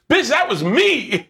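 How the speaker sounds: high, slow, drawn-out gotcha; triumphant punchline. Retold or imitated. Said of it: "he goes".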